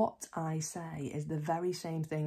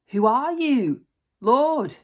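high, loud, startled cry; awed question; 8 semitones higher, 15 dB louder, slower than usual. A narrator's reading. Saul crying out to the Lord in awed surprise. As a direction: cry out in awe at the voice.

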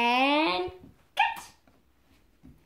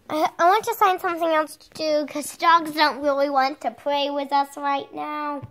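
ordinary talk

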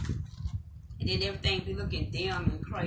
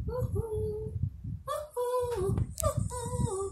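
much higher, soft playful cheering; high woo-hoo; 13 semitones higher, 4 dB quieter, much slower than usual. Acted. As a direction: squeal a little cheer three times. Celebrating the earrings.

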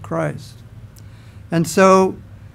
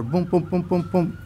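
imitated knocking; 7 dB quieter than usual. Host at the kitchen table hears a door knock, imitated softly. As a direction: tap out quick, quiet booms. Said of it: "I hear a knock on the door".

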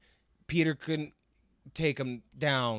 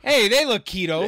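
loud, higher comic exclamation, half laughing; amused, raised-voice character quip; playful mock Vermin; 7 semitones higher, 11 dB louder than usual. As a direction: call out like a monster spotting a snack. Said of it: "He goes".

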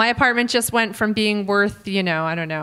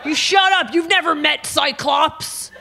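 higher, louder indignant snake owner; mock defensive owner; 8 semitones higher, 4 dB louder than usual. Comic voicing the snake owner telling off the host, mock indignant. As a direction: huff it out like a touchy pet owner.

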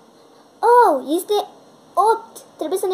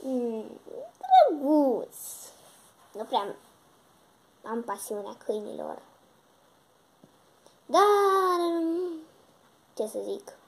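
unimpressed friend; 5 dB quieter than usual. Acted. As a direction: sound cool and unconvinced. Sunshine's voice.

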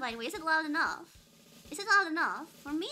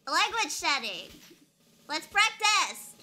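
excited call-out; 4 semitones higher, 6 dB louder, slower than usual. Real, not acted. Hyped game menu call-out.

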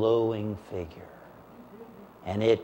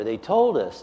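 ordinary talk

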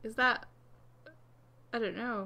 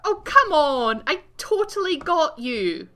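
loud, raised indignant complaint; exasperated protest; 5 semitones higher, 10 dB louder than usual. Real, not acted. Gamer cheated of a kill, exasperated protest. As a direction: protest loudly at being cheated.

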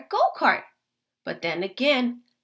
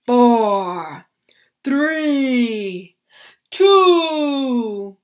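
big booming race announcer, much louder; boomy announcer; 11 dB louder, much slower than usual. A narrator's reading. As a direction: call the start across the field. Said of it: "the announcer's big, boomy voice".

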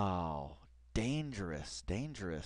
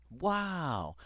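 normal talking voice

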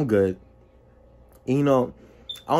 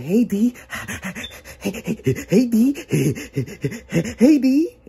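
demon-possessed DJ; 8 semitones higher, slower than usual. Retold or imitated. Possessed DJ gets up in his friend's face, manic. Voicing the possessed DJ accosting him.

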